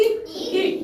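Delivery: ई read off quickly, a short 'i'; short I sound. Shown as a demonstration. Teacher explains small ई is read fast, quick short ई. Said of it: "आई की sound क्या होगी".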